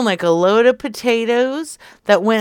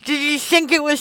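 louder, higher, breaking into a laugh; amused, raised-voice apology; laughing confession; 5 semitones higher, 8 dB louder, faster than usual. Real, not acted. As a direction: own up to the prank through giggles. Owns up to prank, laughing.

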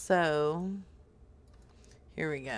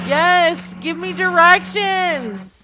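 panicked, higher and louder; frantic yelling; 3 semitones higher, 8 dB louder than usual. Acted. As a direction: yell it in a driving panic. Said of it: "so it sounds like you're frantic".

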